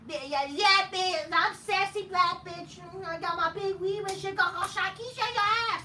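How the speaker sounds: over-the-top high mocking sass; Sasha Banks parody. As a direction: ham up a sassy wrestler's trash talk. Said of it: "That's what Sasha Banks basically said".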